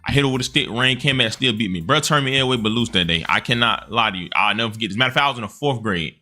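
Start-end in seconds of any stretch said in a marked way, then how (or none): none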